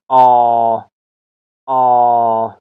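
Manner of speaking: "آ" held long and even; drawn-out long vowel. Shown as a demonstration. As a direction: stretch "آ" out as a long open "ah". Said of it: "کشیده تلفظ شود".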